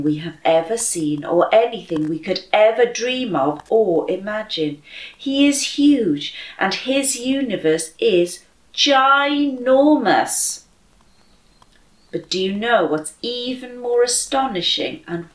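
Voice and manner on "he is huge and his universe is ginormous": ordinary delivery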